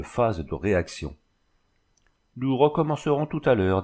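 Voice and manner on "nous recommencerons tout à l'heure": declaring. A narrator's reading. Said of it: "déclara-t-il".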